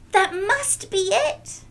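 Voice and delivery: high, loud and slow, thrilled; a girl sure she found it; excited discovery. Acted. Nellie spotting the basket, high and thrilled.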